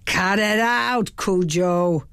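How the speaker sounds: understated yell from the back of the house; narrator's restrained yell, words stretched out; mild mock yell. A narrator's reading. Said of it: "a deep voice from the back of the house yelled".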